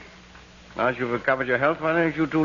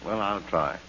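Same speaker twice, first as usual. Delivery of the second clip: usual speaking voice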